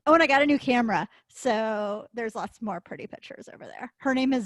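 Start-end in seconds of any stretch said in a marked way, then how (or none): none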